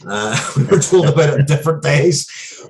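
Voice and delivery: giggling through the joke; laughing. Real, not acted. To the host after joking the award wasn't fixed, laughing.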